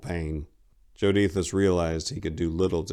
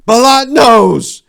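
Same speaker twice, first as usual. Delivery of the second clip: high, loud anguished shout; 17 dB louder, much slower than usual. Acted. Silenus shouting at Tor in grief, loud anguished cry. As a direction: cry it out to the heavens. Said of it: "Silenus shouted".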